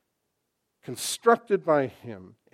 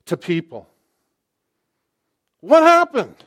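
loud, higher, impassioned questioning; preacher crying out rhetorical questions; full-force preaching; 4 semitones higher, 9 dB louder than usual. Acted. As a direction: rail at the church's confusion.

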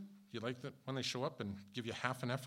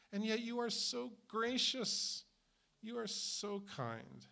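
tender thanks; 10 semitones higher, slower than usual. Real, not acted. Thanking the old pastor tenderly.

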